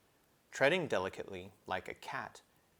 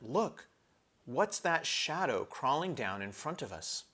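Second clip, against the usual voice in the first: higher, eager exclamation; great excitement; 5 semitones higher than usual. Acted. Edmund spotting the shadow, excited higher cry. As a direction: point it out, thrilled. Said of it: "he said in great excitement".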